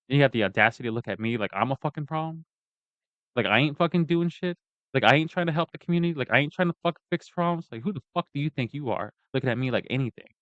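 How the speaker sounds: indignant rant. Real, not acted. Speaker challenging those who treat him as a problem, indignant. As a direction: confront your accusers, pitch climbing in disbelief.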